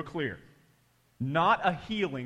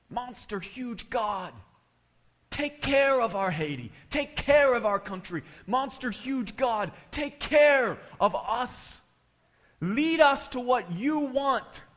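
slow, high imitated pleading, growing loud; drawn-out fervent prayer; 8 semitones higher, 4 dB louder, slower than usual. Pastor praying for Haiti's care, slow fervent imitation. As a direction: stretch each plea out to heaven.

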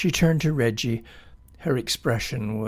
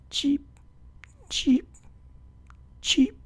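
whispered high chirps; tiny hushed bird cheeps; soft cheeping; 14 semitones higher than usual. A narrator's reading. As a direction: barely breathe the cheeps. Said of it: "cheeping softly on the branch above them".

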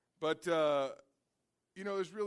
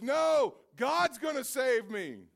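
high, loud, slow shouted refusal; stubborn shout; 8 semitones higher, 10 dB louder, much slower than usual. Acted. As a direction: yell back at the rescuers. Man refuses helicopter, shouting.

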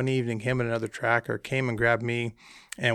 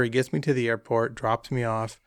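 ordinary talk, nothing marked

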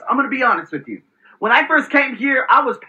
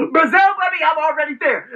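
excited shout; 6 semitones higher than usual. Real, not acted. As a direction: shout it out like a victory call. Hyping his global reach.

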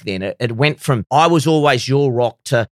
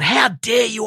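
angry outburst; 9 semitones higher than usual. Retold or imitated. Furious inner outburst at himself.

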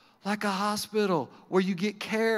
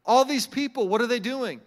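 ordinary talk, nothing marked

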